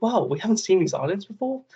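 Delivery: excited discovery of new islands; amazed wow. Retold or imitated. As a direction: gasp it like a first sighting. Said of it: "I was like".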